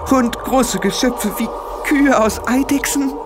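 feverish, very high-pitched raving; blurted out. A narrator's reading. Tiffany describes her visions to Frau Grad, blurted out. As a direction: gasp out each vision in a strained high voice. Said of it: "stieß Tiffany hervor".

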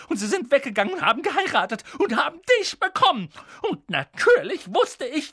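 hohe, keifende Schimpftirade; schimpfend. A narrator's reading. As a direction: lass die Wut aller Jahre raus. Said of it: "fing dann erneut an zu schimpfen". (Tante Petunia schimpft über ihre Schwester)

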